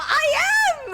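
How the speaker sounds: high, loud, playful insistence; indignant protest. Real, not acted. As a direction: shout back in mock outrage. Playfully insisting against chat's denial.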